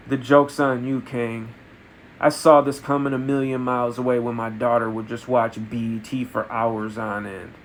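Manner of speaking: low, understated villain reveal; subdued, knowing taunt; quiet gloating. Acted. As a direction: let the payback out softly and low. Scott's quiet gloating reveal to Kang.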